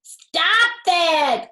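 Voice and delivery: high, loud cry to stop. A narrator's reading. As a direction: shout at the kids to stop. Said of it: "cried Fox".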